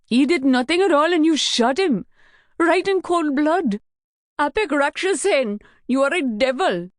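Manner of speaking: high, loud outraged screaming; furious near-scream. Acted. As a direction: hurl the accusation in horror. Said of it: "he was almost screaming".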